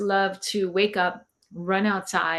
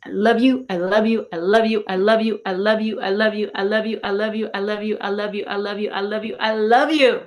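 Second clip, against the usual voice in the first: louder, fervent self love repeated; loving affirmation; 2 semitones higher, 6 dB louder than usual. Retold or imitated. Looking at her reflection, saying loud fervent I love you. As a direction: pour love into each one. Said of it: "and I say".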